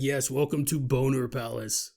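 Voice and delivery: low creature voice welcoming a guest; low monster host; Bigfoot. Acted. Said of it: "He's like".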